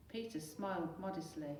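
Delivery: plain reading voice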